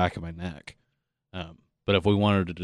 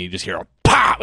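ordinary talk, nothing marked

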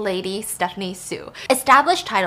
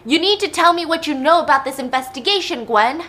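loud, raised demand from an enraged dad; angry father; 5 semitones higher, 5 dB louder than usual. Acted. Dad interrogating Gwen about the investigation, angry demand. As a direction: press her hard, voice up. Dad acted while beating daughter.

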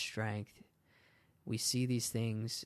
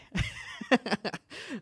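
bursting into a high laugh at herself; real laugh; 10 semitones higher, 6 dB louder than usual. Real, not acted. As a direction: laugh out loud at your own rambling. Laughs at own tangent.

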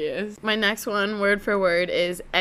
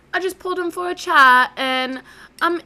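high, loud British reality-show islander; hammed-up British dating-show contestant; Love Island British accent; 6 semitones higher, 4 dB louder than usual. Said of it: "because their accents are the best".

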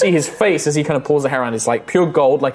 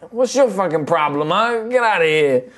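put-on grumpy drunk; 4 semitones higher than usual. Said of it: "He's like".

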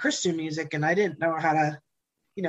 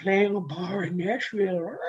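mock intimidation. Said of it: "this big intimidating thing".

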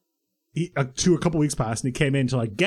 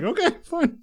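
high meek pupils; 14 semitones higher than usual. Retold or imitated. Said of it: "we're like".

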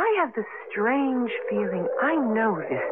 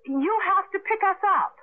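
speaking up; 6 semitones higher than usual. Acted. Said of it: "Speak up".

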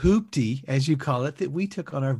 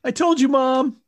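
put-on fast, high, loud kid whine; whiny yell; 9 semitones higher, 5 dB louder than usual. Kid yelling at mom.

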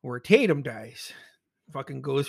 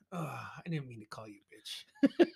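laughing whisper; 7 dB quieter than usual. Real, not acted. Apologizing to the screen, whispered.

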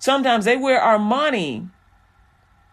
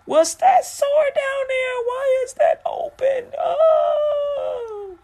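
much higher, squealing panic ending in a shout; shocked falsetto; much slower than usual. Retold or imitated. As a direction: freak out in a squeaky falsetto at the sore. Man discovering a sore, mocking.

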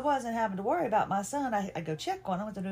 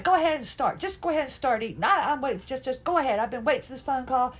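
high, louder, hurried mock snippiness; pissy and snippety; 5 semitones higher, 4 dB louder, faster than usual. Wife telling husband to start eating without her, snippety and loud. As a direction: snip at them impatiently, pitched up. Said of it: "That was me getting all pissy and snippety".

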